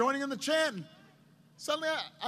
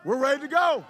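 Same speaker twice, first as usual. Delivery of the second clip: loud, raised group shout; rousing response; 3 semitones higher, 8 dB louder than usual. Retold or imitated. Staff answer the candidate's question, rousing response. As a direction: answer the call at full volume. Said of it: "they'd say".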